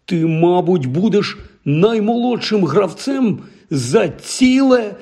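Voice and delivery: high, slowing wonder; amazed. A narrator's reading. Ron realising Harry's record to his face, amazed. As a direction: stretch it out in awe.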